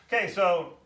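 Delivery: ordinary talk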